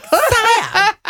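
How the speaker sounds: high, campy sidekick voice; camp sidekick voice. Retold or imitated. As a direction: squeal it like a fussy sidekick. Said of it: "He was like".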